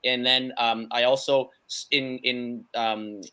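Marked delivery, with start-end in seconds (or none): none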